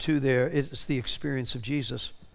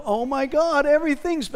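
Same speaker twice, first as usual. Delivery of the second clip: higher, overwhelmed gasp of discovery; amazed exclamation; 10 semitones higher, 4 dB louder than usual. Retold or imitated. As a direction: retell her dawning amazement. Retelling a person's revelation.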